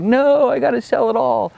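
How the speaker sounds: high, mock-frantic insistence; a hint of panicked stubbornness; mock insistent self. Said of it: "And I was like".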